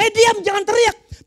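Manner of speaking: high, loud order to hush; scolding shout. Acted. Crowd silencing the beggar, scolding shout. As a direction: shout them down sharply.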